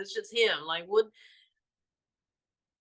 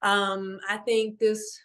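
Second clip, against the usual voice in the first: ordinary delivery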